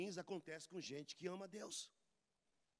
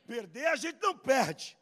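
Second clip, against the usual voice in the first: loud declaration; 8 semitones higher, 15 dB louder than usual. Real, not acted. Preacher declaring victory.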